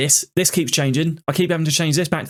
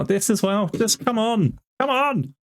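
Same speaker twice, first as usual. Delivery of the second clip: exasperated plea; 8 semitones higher, slower than usual. Real, not acted. Pleading with the game.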